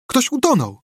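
very high-pitched exclamation; excited cry. A narrator's reading. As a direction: call out the news to your friends. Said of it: "zawołał Tomek". (podekscytowany, wysoki okrzyk)

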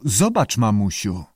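ordinary delivery, nothing marked

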